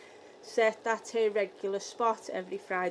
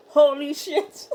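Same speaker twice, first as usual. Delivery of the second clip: slow, loud amazed gasp of words; stunned; 3 semitones higher, 8 dB louder, slower than usual. Retold or imitated. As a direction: drag it out, amazed. Stunned exclamation, drawn out.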